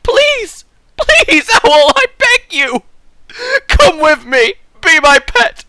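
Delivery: high, loud hammed-up pleading; desperate begging. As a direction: beg the owl like a child. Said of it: "I'm like".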